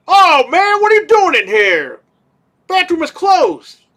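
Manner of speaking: high, loud put-on voice of an annoyed cleaner; bathroom cleaner. Cleaner telling him off in the bathroom, high loud mock voice. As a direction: squawk it out like a startled janitor.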